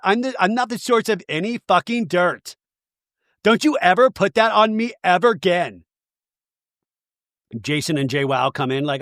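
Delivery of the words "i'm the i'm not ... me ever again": higher, emphatic imitation; mock indignant denial. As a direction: deny it with hammed-up indignation. Retold quarrel.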